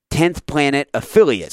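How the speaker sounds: ordinary delivery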